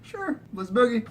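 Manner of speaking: very high, excited party voice; squealing let's-boogie excitement; mock party hype. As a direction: hype it up like the beat just dropped. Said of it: "I'd be like".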